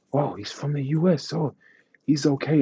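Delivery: high, amazed crowd voice; imitating people relieved and impressed; mock relieved onlookers. Onlookers relieved he's American.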